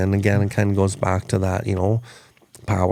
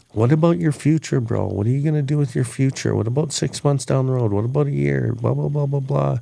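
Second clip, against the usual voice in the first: higher-pitched mock nagging of a friend; badgering lecture; 5 semitones higher than usual. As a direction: pester with a string of questions, self-mocking. Mimics own past lecturing of helper.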